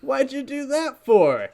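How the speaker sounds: high, slow cartoon protest; drawn-out, high-pitched complaint; Simba. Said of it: "Simba goes".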